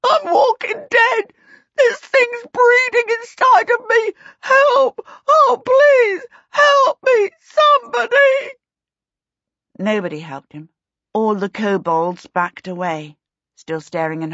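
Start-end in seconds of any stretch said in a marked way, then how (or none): 0.0-8.5 very high, loud wailing for help; blubbering. A narrator's reading. Rollo begs the watching kobolds for help, loud tearful blubbering. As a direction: wail hysterically, pitched far above your own voice. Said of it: "he blubbered".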